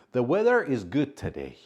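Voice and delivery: The weather is good today read out high and loud; prompt sentence. Shown as a demonstration. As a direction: present the example sentence brightly. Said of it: "How would you answer it?".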